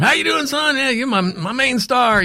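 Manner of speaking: high, hammed-up Southern accent; Charlie. Southern boss gushes over his new star, exaggerated accent. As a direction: gush like a cartoon Southern good old boy. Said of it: "right out of Dukes of Hazzard".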